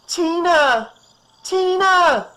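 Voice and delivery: very high, loud, desperate call; a mother calling for her lost daughter, hysterical. Acted. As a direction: scream her daughter's name into the woods. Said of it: "weary and hysterical voice pierced the night".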